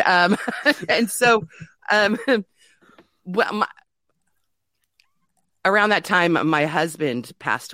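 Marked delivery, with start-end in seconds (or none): none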